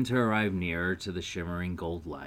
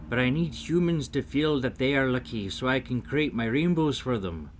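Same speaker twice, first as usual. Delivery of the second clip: higher, louder storybook little man; earnest, raised character voice; pleading leprechaun; 6 semitones higher, 6 dB louder than usual. Acted. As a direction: plead your case emphatically as a leprechaun.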